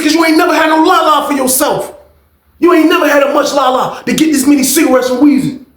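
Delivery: high, insistent put-down; retold taunt. Inmate belittling his rival over cigarettes, high and heated.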